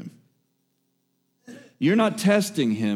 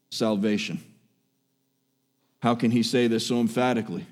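usual speaking voice

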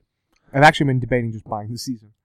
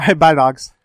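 quick goodbye call; 8 dB louder, slower than usual. Acted.

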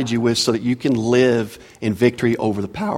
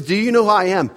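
pitched high, mocking the entitled passenger; hammed-up arrogant passenger voice; arrogant demand; 7 semitones higher than usual. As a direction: sneer it like a pompous big shot. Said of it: "So he says".

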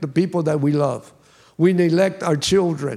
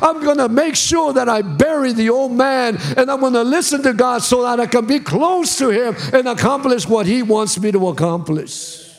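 fervent preacher lifting the crowd; preaching; 5 semitones higher than usual. Acted. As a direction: preach it out with full fire. Preaching climax.

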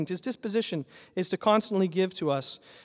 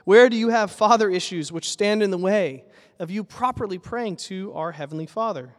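usual speaking voice

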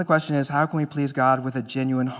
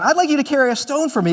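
higher and faster, an emphatic Jesus; brisk repeated request; 9 semitones higher, 4 dB louder, faster than usual. Acted. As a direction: hurry the line out, pressing it again.

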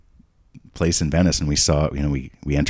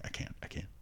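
breathless whispered awe; dad overcome at Wagner's piano, whispering; overwhelmed whisper; 19 dB quieter than usual. Retold or imitated. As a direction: whisper it, too moved to speak. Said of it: "It's like".

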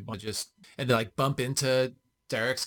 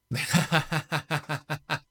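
long staccato in-character laugh; mocking laugh; 5 semitones higher than usual. Player laughs at goblin's offer to talk, long mock laughter. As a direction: laugh in his face, drawn out. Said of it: "I start laughing".

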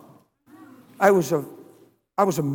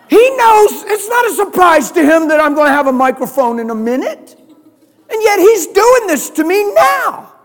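loud, high, shouting sermon build; a preacher shouting his point home; full-force preaching; 5 semitones higher, 9 dB louder than usual. Acted. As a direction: preach it hard to the congregation.

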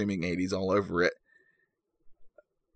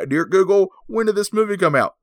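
higher, louder mock-formal address, fast; mock formal plea; 9 semitones higher, 6 dB louder than usual. Reviewer jokingly addresses Google, mock-grand higher call.

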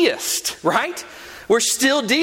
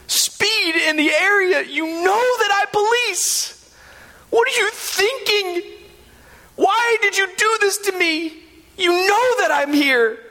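higher, hammed-up tearful plea between sobs; mock weeping cop; 11 semitones higher than usual. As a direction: play a heartbroken cop sobbing over a speeder. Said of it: "he's just weeping".

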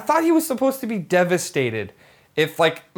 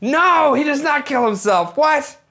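higher, louder burst of disbelief; indignant protest; 7 semitones higher, 3 dB louder, slower than usual. Real, not acted. Viewer objecting to the friend's predicted ending, indignant.

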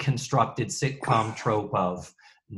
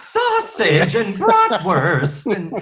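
laughing; 7 semitones higher, 6 dB louder, slower than usual. Real, not acted. Listing items while laughing.

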